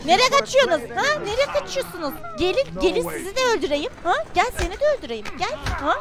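mock taunt. Taunting fleeing enemies.

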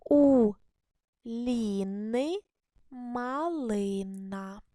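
very slow, every word stretched out; reading the sentence slowly. Shown as a demonstration. Teacher reads the second sentence aloud for pupils, very slowly. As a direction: sound out each word for little readers. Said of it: "І ще у вас одне буде речення".

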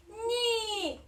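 high, loud, drawn-out snowflake voice; playful high cry of denial; laughing snowflake. A narrator's reading. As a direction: call it out merrily, without laughing. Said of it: "засміялася сніжинка".

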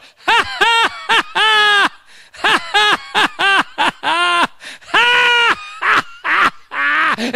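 loud, high, scornful belly laugh; God's mocking laugh. God laughing at the sinner, loud scornful laugh. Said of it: "I'm gonna laugh".

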